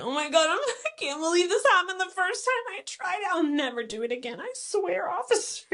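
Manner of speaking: high, pleading, hammed-up innocence; fake innocent act. Hamming up innocence to talk her way out with the officer. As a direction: play the sorry good girl for the cop. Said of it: "you know I'm an actress".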